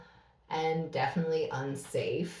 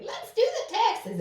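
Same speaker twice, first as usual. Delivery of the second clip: very high-pitched mimicry; mock tax-day announcement. Wife mimicking her husband at tax time, mock high voice. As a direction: squeal it in a teasing, put-on high voice.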